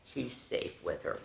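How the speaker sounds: soft unvoiced whisper. Teacher telling children the cub is safe, whispered. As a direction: whisper it tenderly. Soft aside about baby.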